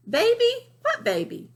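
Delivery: high, loud feigned ignorance; mock-innocent Lily. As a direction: pretend the baby isn't there, all innocence. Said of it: "right here she says".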